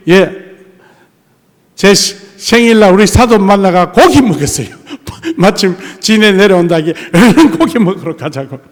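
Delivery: ordinary talk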